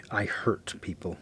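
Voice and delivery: ordinary delivery, nothing marked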